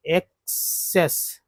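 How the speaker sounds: एक्सेस very slow, एक then सेस; teacher models एक्सेस slowly; slow pronunciation demo. Shown as a demonstration. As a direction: draw out एक्सेस piece by piece. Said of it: "एसी को एक बोलते हैं".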